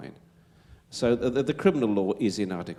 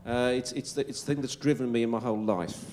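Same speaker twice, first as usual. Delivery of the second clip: normal talking voice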